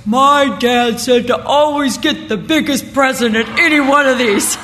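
put-on loud, eager little boy; imitating a grandson declaring his plan; nine-year-old grandson. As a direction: announce it proudly like a child. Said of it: "he's nine, he goes".

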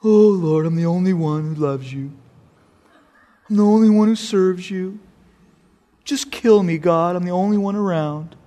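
self-pitying whine, voice pinched and put-on; a prophet whining in despair, feeling sorry for himself; whining Elijah. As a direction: moan it like a sulking prophet. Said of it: "He runs and he whines".

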